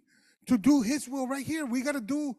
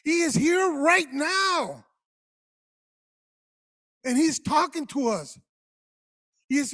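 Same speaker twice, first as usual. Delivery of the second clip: slow, loud, higher preaching; preaching at full force; 5 semitones higher, 6 dB louder than usual. Acted. Pastor voices God calling the church to act, slow loud preaching. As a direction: call them out slowly, higher and louder.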